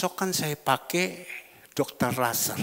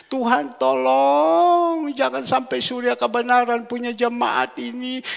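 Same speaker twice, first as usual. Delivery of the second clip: plea to God; 7 semitones higher, 6 dB louder than usual. Acted. Crying out to God.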